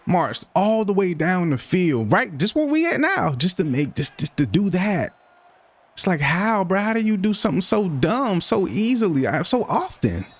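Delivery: can't believe how dumb that was; incredulous rant. Real, not acted. Disbelief at his own interception.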